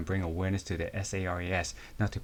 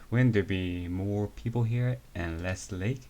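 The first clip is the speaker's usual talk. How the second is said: usual speaking voice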